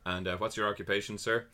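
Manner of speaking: a quietly cold officer cutting in; curt officer. Retold or imitated. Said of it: "cuts me off and goes".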